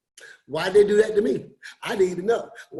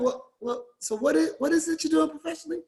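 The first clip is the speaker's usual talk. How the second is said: higher, fast insistent questions; prying coworker; 6 semitones higher, faster than usual. Retold or imitated. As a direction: push for details. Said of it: "she kept saying".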